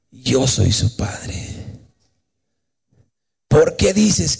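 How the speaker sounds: very slow, solemn divine voice; measured, grave voice from heaven; voice of God. Retold or imitated. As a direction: speak as God, each phrase stretched with long pauses. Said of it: "vino una voz y me dijo".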